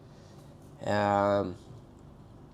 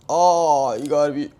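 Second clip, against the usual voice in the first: ordinary delivery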